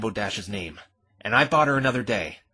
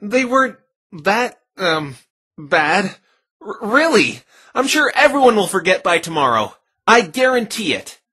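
nervous reassurance; 8 semitones higher, 7 dB louder than usual. Acted. Phoenix nervously reassuring her.

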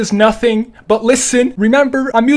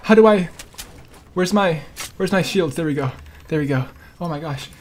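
ordinary delivery

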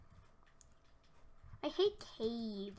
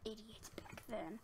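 soft whisper, calling himself an idiot; whispered self-mockery; 7 dB quieter than usual. Real, not acted. Gamer teasing his past self in whisper. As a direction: hush it like a confession.

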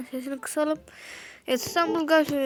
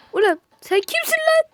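outraged yell; 13 semitones higher, 7 dB louder than usual. Real, not acted. Yells at rival player.

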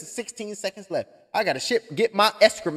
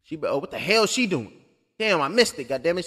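ordinary talk, nothing marked

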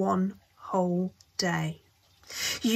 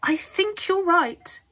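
startled agreement; 9 semitones higher, 4 dB louder, slower than usual. A narrator's reading.

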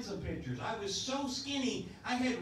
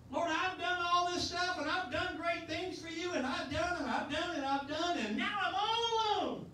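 higher, building to a whimpering cry on "now I'm all alone"; whiny self-pity; 6 semitones higher than usual. Retold or imitated. Prophet Elijah whining to God that he's all alone, near tears. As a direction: play it like a wounded prophet begging for sympathy.

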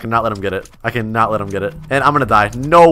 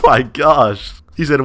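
ordinary talk

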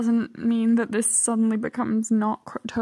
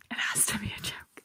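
whisper; 3 semitones lower, 10 dB quieter than usual. Real, not acted. Pleading disbelief at a comment.

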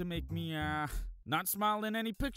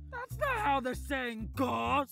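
exasperated outburst; 11 semitones higher, 4 dB louder, slower than usual. Acted. Corrects himself.

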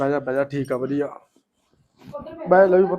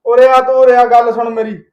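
loud, raised-pitch summons; angry shouted order; 2 semitones higher, 10 dB louder than usual. Acted. Husband calls wife to account, angry shout. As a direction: order her over at full voice.

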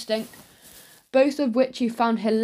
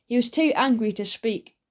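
ordinary delivery

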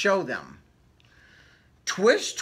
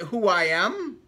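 usual speaking voice

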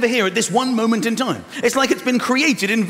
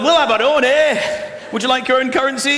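mock Scottish bluster; 5 semitones higher, 7 dB louder than usual. Voicing Scots about currency.

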